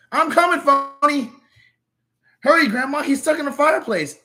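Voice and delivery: high, loud alarmed shouts; urgent calling. Acted. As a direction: call for help in a hurry. Calling out during rescue.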